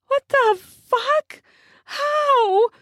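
high, slow, stunned gasp of disbelief; shocked. Acted. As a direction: blurt it out in sudden shock. Sudden unexpected kiss from another.

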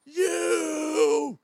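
high, drawn-out screamed taunt; imitated heckler's long scream; retold screaming. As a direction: scream it at him, stretching each word. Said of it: "he started screaming at him".

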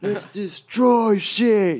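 slow, high rallying yell; amped-up, wild holler; hyped-up shout. Retold or imitated. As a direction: draw it out like a war cry. Acting out wired burst of energy.

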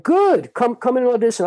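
high-pitched mock casting director; imitated eager invitation. Casting director calling a breakdancer in to audition, eager.

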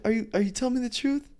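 half-laughing pleading for the truth; overjoyed suspicion; giddy disbelief. Retold or imitated. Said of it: "I started cursing".

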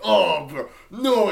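a hint of a dumb demon bro.

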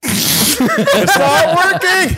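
high squeaky laughing words; cracking up mid-sentence. Real, not acted. Cake cup stunt fails.

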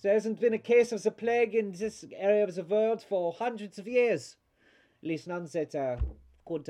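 put-on high, loud, thickly accented voice; dismissive accented doctor. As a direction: scoff as an eccentric foreign doctor. Game master voices accented doctor.

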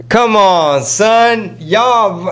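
high, drawn-out disbelief; exasperated protest. Real, not acted.

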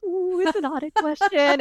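high-pitched giddy squeal of delight; light up gleefully, voice leaping way up. Retold or imitated. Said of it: "I get giddy".

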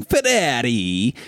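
Ferrari drawn out slow in a hammed-up Italian accent. Shown as a demonstration. Mocking car fans, a slow hammed-up Italian Ferrari. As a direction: roll Ferrari out slowly like a mock Italian. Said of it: "pronounce Ferrari like an Italian".

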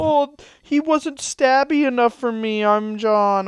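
high, slow mocking voice of John; hammed-up impression of John, pitched high. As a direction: mimic John as a whiny complainer. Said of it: "Not what I sound like".

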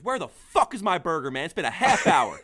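a mock yell at the bartender, slow and heated; retold yell. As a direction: bellow it like an irate old man. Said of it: "starts screaming at me and goes".

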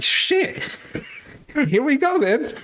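high, unvoiced resigned dread; mock dread before facing a monster. Me facing prime Tyson, high mock dread. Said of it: "I'd be like".

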